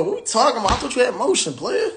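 high mocking jab at a broke renter; mock disbelief, high and teasing; mocking taunt. Real, not acted. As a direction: tease him, pitch up high and scoffing.

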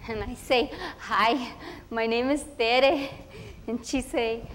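eager chatty schoolgirl voice, Spanish mixed in; a proud first-grader telling her day in a rush; little girl Tere. Acted. Girl telling about her first school day, eager and chatty.

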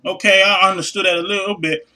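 usual speaking voice

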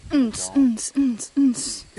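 rhythmic club beat mimicry; beatbox unts. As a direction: thump out a dance beat.

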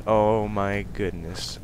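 ordinary delivery

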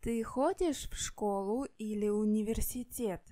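ordinary talk

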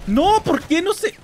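indignant outcry. Real, not acted. Gamer reacting to a loss, high indignant outcry. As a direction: protest it in shock. Frustrated at losing.

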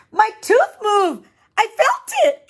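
put-on a boy thrilled by his wobbly tooth; excited child. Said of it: "he's excited".

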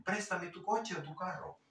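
usual speaking voice

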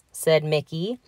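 ordinary delivery

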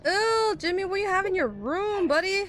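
high, loud disgusted whine; squealing ew of disgust; grossed out. Real, not acted. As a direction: recoil at the smell of the room. Disgusted at creepy room.

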